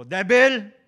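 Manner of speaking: loud, high rebuke shout. Retold or imitated. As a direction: command the devil away. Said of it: "Sabi ko". (malakas na saway sa demonyo)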